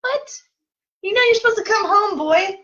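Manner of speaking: squeaky high outraged what; mock disbelief. Mom teasing son who missed his stop, high mock outrage. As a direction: playfully scold the boy in disbelief.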